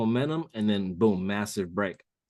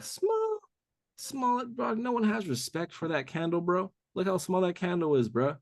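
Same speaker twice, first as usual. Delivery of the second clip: mock pity; 8 semitones higher than usual. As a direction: talk down to it like a sad little thing. Mocking the tiny candle.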